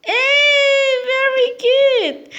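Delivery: very high, loud, slow praise; excited praise. Real, not acted. Praising toddler's answer.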